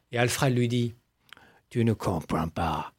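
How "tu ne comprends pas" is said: put-on Hitchcock accent. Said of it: "j'ai un peu bossé mon accent hitchcockien".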